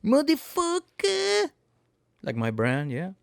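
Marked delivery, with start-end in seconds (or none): 0.0-1.5 much higher, slow drawn-out squeal; a cartoonish exasperated falsetto, much higher; mock outraged squeal. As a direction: squeal each word, stretched out slowly. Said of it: "I'm just going".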